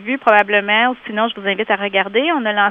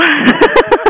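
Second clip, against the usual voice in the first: burst of laughter; 8 semitones higher, 7 dB louder than usual. Real, not acted. Laughs at her own joke.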